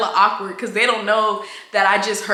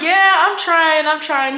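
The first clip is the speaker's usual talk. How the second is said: bashful reply; 6 semitones higher, 4 dB louder, slower than usual. Retold or imitated. Acts out her flirty reply.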